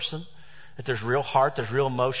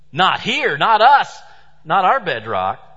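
loud, insistent denial; building indignation, each phrase harder; mock crowd insisting it cannot happen here; rising protest; 4 semitones higher, 7 dB louder, slower than usual. Crowd refusing the earthquake.

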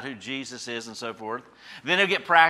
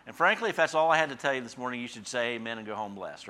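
usual speaking voice

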